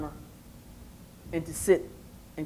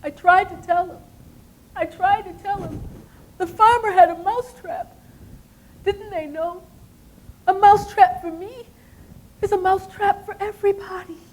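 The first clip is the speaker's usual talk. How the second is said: sad mouse; 10 semitones higher than usual. Acted. Mouse sadly reflecting from its hidey hole. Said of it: "And he said".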